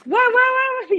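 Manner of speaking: high, rapid overlapping-style clamor; excited children. Retold or imitated. Children answering the robot puppet, high excited clamor. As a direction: squeal it like a roomful of curious kids. Retelling children's eager chorus.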